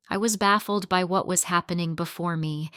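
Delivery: plain reading voice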